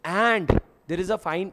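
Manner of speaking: slow, lean hard on "And"; stress on and. Shown as a demonstration. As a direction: draw out "And" to show it links jail and fine. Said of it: "दोनों के बीच में लिखा है and". ("And" पर ज़ोर, धीरे)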